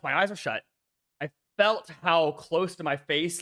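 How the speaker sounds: ordinary delivery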